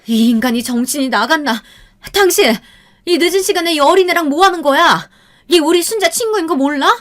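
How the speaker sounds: wife grabbing her husband's collar, screaming; furious wife. A narrator's reading. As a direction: scream at him in shocked fury. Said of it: "충격에 빠져 곧장 아버지의 멱살을".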